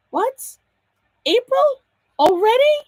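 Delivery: high, startled replay of her own reaction; surprised. Retold or imitated. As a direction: act caught off guard, pitch jumping up. Retells her reaction to the reminder.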